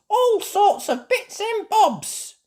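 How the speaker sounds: high, slow laughing sales call; slow stall-keeper call. A narrator's reading. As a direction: sing out the wares. Elephant selling.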